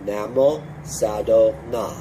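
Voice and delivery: slow, careful Namo Sado Na; teacher sounding out Namo Sado Na slowly; slow syllable demo. Shown as a demonstration. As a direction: spell out each syllable slowly. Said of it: "let's go through this syllable by syllable".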